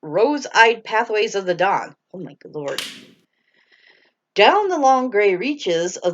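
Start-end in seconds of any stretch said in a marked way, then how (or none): 2.1-2.8 muttered aside. Real, not acted. Reader exasperated aside.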